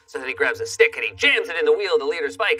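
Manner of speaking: ordinary talk, nothing marked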